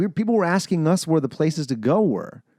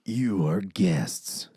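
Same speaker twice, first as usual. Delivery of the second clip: very slow, lowered voice; Bill's deep voice; 4 semitones lower, much slower than usual. Retold or imitated. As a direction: drop low and space out every word. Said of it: "he had such a deep voice".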